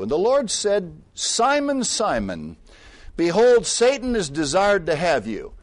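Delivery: high, loud preaching read; booming preached scripture. Acted. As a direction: proclaim the verse with force. Reading scripture with force.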